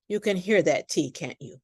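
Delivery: ordinary talk, nothing marked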